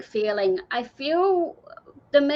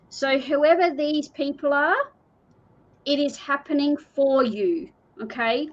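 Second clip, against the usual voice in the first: ordinary talk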